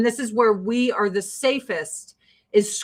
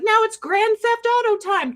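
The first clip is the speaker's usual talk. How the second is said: mock gleeful; 10 semitones higher than usual. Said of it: "They're like".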